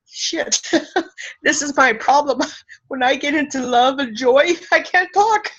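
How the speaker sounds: real tearful laughter, pitched high; giggling helplessly, on the edge of crying; choked-up laughing confession; laughing through tears. Real, not acted. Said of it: "I'm just getting teary-eyed".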